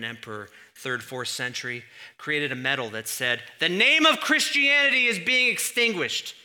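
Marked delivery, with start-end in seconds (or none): 3.6-6.3 proclaimed inscription. Retold or imitated. Reading the emperor's medal.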